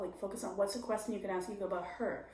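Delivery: ordinary talk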